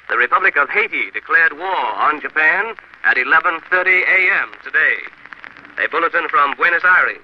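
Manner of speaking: crisp 1940s radio bulletin read. Real, not acted. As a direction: announce the war bulletins like vintage radio.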